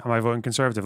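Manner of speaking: usual speaking voice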